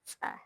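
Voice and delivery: "Tse" with air pressed from the throat through a small hole; ejective ts sound. Shown as a demonstration. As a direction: push out a sharp ts on "Tse". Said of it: "an air press from the throat through a small hole".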